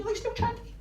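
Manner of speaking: high question. Shown as a demonstration. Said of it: "repeated question".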